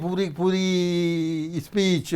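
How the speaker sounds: पूरी speech drawn out slowly on a single held pitch; one unbroken tone. Shown as a demonstration. Teacher demonstrating a monotonous speech, one long level tone. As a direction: drone पूरी speech on one note, stretching the vowels. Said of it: "एक ही तान में".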